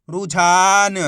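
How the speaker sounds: "रुझान" joined up, with a long loud "झा"; अक्षर मिलाकर उच्चारण. Shown as a demonstration. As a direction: blend "रुझान" slowly as one word for learners. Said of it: "इनको आपस में लाके पढ़ना है". ("रुझान" को जोड़कर, "झा" लंबा खींचकर बोलना)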